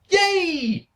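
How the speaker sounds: high mock cheer, dripping sarcasm; sarcastic cheer. Real, not acted. Reviewer mocking a count-out finish, sarcastic high cheer. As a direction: cheer it with heavy irony.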